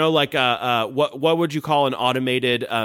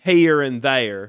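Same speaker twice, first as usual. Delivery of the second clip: slow Southern drawl, vowels stretched; much slower than usual. Shown as a demonstration. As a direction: draw "Here and there" out into two-syllable vowels. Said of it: "another feature of Southern accents".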